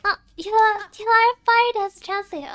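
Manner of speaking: loud mock scolding, half laughing. Boss firing her translator, mock scolding loudly.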